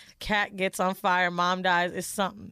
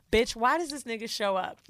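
ordinary delivery

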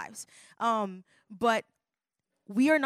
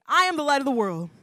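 said together, louder; call-and-response; 4 semitones higher, 8 dB louder than usual. Shown as a demonstration. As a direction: lead the crowd in it. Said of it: "Everybody say that with me".

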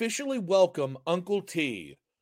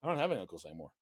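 much lower and softer mock guilt trip; 6 semitones lower, 12 dB quieter, much faster than usual. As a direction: drop low and soft to guilt him. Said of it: "now it's guilt".

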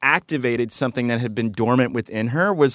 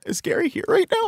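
much higher, put-on voice for a teasing question; silly high voice. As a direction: squeak it out like a cartoon. Playful question about Gary.

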